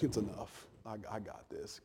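hushed, steady Jesus reassuring the disciples; calm Jesus. Pretend. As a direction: quietly settle their worry. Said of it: "Jesus is like".

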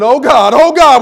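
loud, high panicked cry of "Oh, God"; mock scream. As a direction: yell it like a snake just grabbed you. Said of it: "I'm gonna be screaming".

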